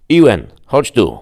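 loud call to his brother. Acted. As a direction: shout across the battlefield. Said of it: "zawołał młodszego brata".

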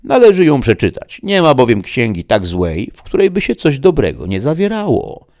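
loud, high, animated opinion; gentleman reader, arguing. Acted. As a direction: make the case emphatically. Don Juan argues for reading.

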